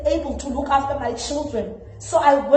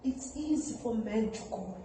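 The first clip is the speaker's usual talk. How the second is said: very soft, lowered voice; quiet bitterness; 5 semitones lower, 14 dB quieter than usual. Acted. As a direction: let it drop to a murmur after a long silence. Pained pause in monologue.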